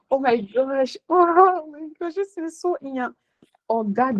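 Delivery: high, slow, squirming mock gasp; mock cringing reaction. Mocking people who fear cringe, high drawn-out squirm. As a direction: act out someone recoiling from cringe.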